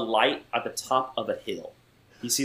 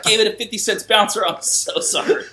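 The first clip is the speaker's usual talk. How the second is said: sheepish apology; 8 semitones higher, 4 dB louder than usual. Retold or imitated. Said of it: "And I was like".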